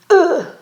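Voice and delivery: high, loud "ugh" of defeat; sudden disgusted groan; exasperated groan. Retold or imitated. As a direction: groan as if caught out. Groans at being caught out.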